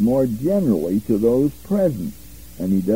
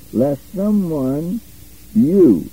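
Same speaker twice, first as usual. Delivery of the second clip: "Lest someone," slow, pause, then "you." hit loud; stress on YOU; 3 semitones higher, 4 dB louder, much slower than usual. Shown as a demonstration. Teacher reading Greek order to class, stressing "you.". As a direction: set "you." apart after a pause and punch it. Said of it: "see that square box around the you?".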